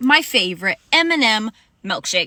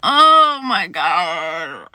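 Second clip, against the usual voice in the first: slow, louder, wheezing through helpless laughter; laugh-crying; 4 semitones lower, 5 dB louder, much slower than usual. Real, not acted. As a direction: gasp it out, dying of laughter. Overcome by laughter at the memory.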